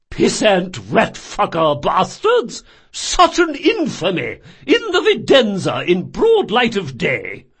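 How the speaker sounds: slow, loud, high outrage; spitting vitriol. Acted. Fat man raging at the thieves, slow loud vitriol. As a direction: relish every curse indignantly. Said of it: "continued to spit vitriol".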